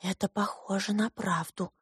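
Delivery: very soft, hushed aside; hushed near-whisper. A narrator's reading. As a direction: whisper it together like awed twins. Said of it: "прошептали сиамские близнецы".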